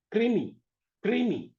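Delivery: क्रीमी with kr fused, long ee; क्रीमी said clearly, kr cluster; say kreemi. Shown as a demonstration. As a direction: demo of क्रीमी. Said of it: "अगला शब्द जो है वो है क्रीमी".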